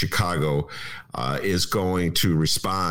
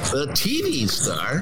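higher, drawn-out radio announcer delivery; put-on radio host voice, pitched up; 5 semitones higher, slower than usual. Shown as a demonstration. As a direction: announce it slowly in a big radio voice. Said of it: "in my radio voice".

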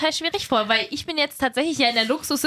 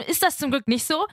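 ordinary talk

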